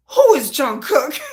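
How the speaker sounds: high, loud, drawn-out question; stunned fan asking who this is; excited disbelief. Retold or imitated. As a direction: shriek it in delighted shock. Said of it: "I'm like".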